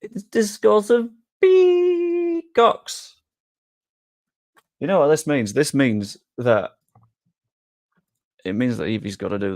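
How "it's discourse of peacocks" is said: high, drawn-out put-on voice; put-on announcer voice. Streamer reluctantly revealing who pulled Scotland, high put-on voice. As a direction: stretch it out high and slow, hammed-up. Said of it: "I don't wanna have to do the voice".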